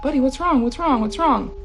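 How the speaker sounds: retold anxious questioning of a pet; worried urging. Owner to the growling dog, worried.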